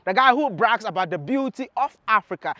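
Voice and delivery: usual speaking voice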